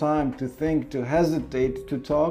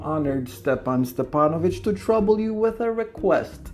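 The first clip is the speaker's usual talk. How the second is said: higher, nervous suitor voice; nervous Lamov; 3 semitones higher than usual. Acted. Suitor stammering his request to his neighbour, nervous and higher. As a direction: fumble and rush like a jittery suitor.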